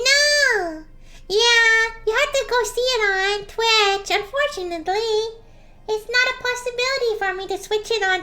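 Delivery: slime voice changer. Acted. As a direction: play the cheerful slime character through a voice changer. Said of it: "you're gonna hear my voice change".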